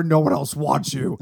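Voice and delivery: slow gravel-free Nick Nolte put-on; cold belittling sneer. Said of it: "I went to the Nick Nolte voice again".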